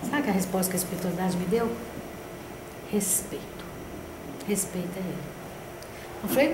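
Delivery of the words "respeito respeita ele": softer, nearly whispered answer; hushed spirit voice. Retold or imitated. Spirit guidance answering grandmother, quiet and hushed. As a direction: breathe it like a voice from beyond.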